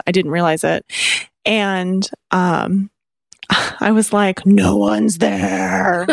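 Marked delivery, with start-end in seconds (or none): 4.5-6.1 drawn-out growl at the dogs. Retold or imitated. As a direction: growl it slowly, stretching each word. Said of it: "I was growling at them".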